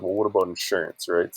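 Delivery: normal talking voice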